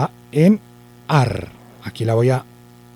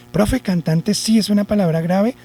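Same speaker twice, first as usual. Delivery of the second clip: imagined pupil; 6 semitones higher than usual. Acted. Pupil asks teacher about the word, imagined pupil voice. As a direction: put on a higher questioning pupil voice.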